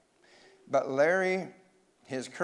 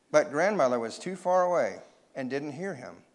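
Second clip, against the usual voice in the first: usual speaking voice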